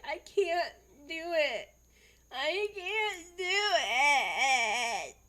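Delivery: imitating a whiny wail, high and drawn out; kindergartner crying. Upset kindergartner who can't do the task, mock crying. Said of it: "just started crying".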